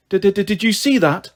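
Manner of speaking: slow, stammered fright; nervous spluttering. A narrator's reading. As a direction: stutter on the first word in alarm. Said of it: "spluttered nervously".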